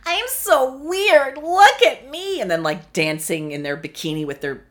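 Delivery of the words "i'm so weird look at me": much higher, louder, slow put-on squeal; mock quirky girl. Mocking a hot girl's fake weirdness, squealing. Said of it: "a hot girl being like".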